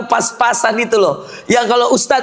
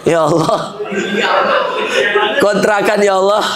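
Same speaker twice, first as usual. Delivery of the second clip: mock tearful prayer. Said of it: "doa pakai nangis".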